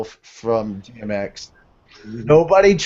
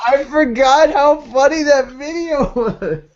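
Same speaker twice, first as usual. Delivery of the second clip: laughing; 10 semitones higher, 3 dB louder, slower than usual. Real, not acted. Still laughing at video.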